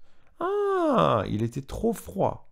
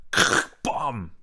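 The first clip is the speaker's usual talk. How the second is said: chair crash noise; 4 dB louder than usual. Acted. Chair breaks in story.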